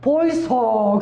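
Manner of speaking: loud Busan-dialect wake-up call. Retold or imitated. Preacher waking sleeping passengers, loud Busan-dialect call. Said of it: "이제 부산 사람이 제가".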